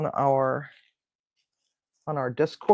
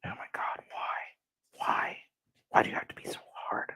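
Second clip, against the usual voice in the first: fast, breathless whisper at the food; pleading whisper; 12 dB quieter than usual. Real, not acted. Cook pleading with a stubborn dish, whispering. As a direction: beg the dish under your breath.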